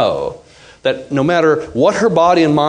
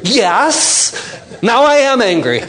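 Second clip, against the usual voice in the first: her voice, higher; 9 semitones higher, slower than usual. Retold or imitated. Wife voiced in argument.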